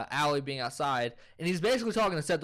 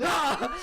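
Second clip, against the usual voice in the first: high, loud mock roar; mock angry yell; 12 semitones higher, 4 dB louder than usual. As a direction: yell as if punching a wall, in imitation. Acting out punching the wall.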